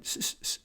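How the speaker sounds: quick voiceless hisses; mouth-made fight effects; imitated punch noises. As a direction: hiss out fast jab sounds.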